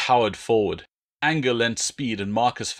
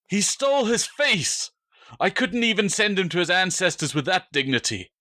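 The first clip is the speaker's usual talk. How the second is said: voice breaking; 9 semitones higher than usual. Acted. Drustanis mourning Spurius to Marcus, voice breaking. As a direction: let the voice crack as the grief breaks through. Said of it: "Drustanis's voice broke".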